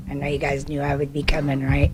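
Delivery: teasing whispered aside; whispering. Real, not acted. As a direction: whisper it to the council playfully. Playful aside to council.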